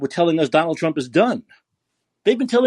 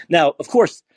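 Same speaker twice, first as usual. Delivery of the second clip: ordinary talk, nothing marked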